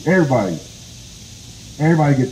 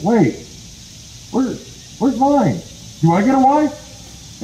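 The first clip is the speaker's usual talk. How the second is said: high, rising questions, drawn out at the end; bewildered demand; 10 semitones higher, slower than usual. Acted. Possum asking rabbit where his wife is, bewildered. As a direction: ask in pitched-up, put-out disbelief.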